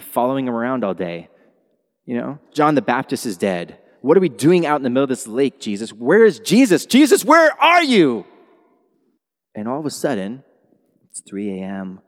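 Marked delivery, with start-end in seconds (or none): 4.0-8.3 frantic cry into the storm; panicked disciples. Acted. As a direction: call out for Jesus in growing fear.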